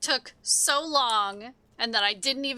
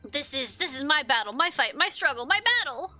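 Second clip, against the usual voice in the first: higher, impassioned kobold voice; defiant kobold Gigu; 6 semitones higher, 4 dB louder than usual. Acted. Gigu telling the adventurer to stand back, defiant. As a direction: declare it with mounting heroic resolve.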